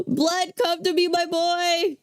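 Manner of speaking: excited calling. Real, not acted. Calling the revived dog over.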